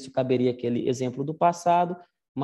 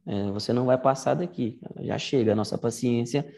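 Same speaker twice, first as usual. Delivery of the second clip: ordinary delivery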